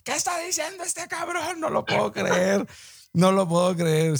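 high, incredulous imitation of Papa Bear; Papa Bear at home, mock disbelief at what he hears. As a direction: mimic the father figure's shocked reaction at home. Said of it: "Ha de estar Papa Bear ahí en su casa".

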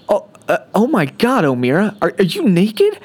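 high, shocked and flustered; disbelief. A narrator's reading. Engineer walks in on naked crewmate, stunned disbelief. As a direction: gasp it out, can't believe it. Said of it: "Moira said in disbelief".

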